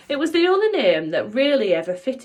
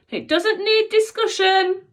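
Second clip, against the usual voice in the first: high, loud call down the corridor; 13 semitones higher, 5 dB louder than usual. A narrator's reading. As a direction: call after the pupils. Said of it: "she called after her pupils".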